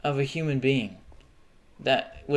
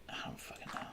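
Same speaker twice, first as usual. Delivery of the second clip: whisper; 19 dB quieter, faster than usual. Real, not acted. Frustrated aside.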